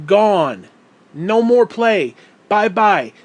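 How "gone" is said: Gone said alone, loud and falling; stress on gone. Real, not acted. Telling his audience the game is over, hitting Gone. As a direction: land hard on the word Gone. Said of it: "No, hear the word".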